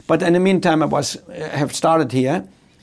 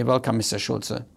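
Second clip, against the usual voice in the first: soft personal welcome; 5 semitones lower, 7 dB quieter than usual. Retold or imitated. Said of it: "she would have called me".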